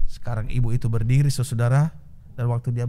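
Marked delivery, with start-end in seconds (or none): none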